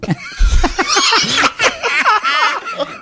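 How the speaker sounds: high, helpless laughing fit; shrieking laugh. Real, not acted. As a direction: burst out laughing. Laugh at baby joke. (carcajada aguda)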